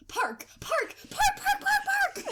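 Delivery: rising to a high, loud frantic yell; excited shouting. Retold or imitated. Imitating roommate sleep-talking, rising excited yells. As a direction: build from one word to frantic shouting. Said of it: "but excitedly".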